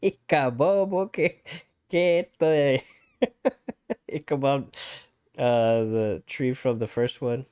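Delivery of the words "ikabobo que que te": Ikabobo que read in a higher, laughing Spanish voice. Real, not acted. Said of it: "I translated it".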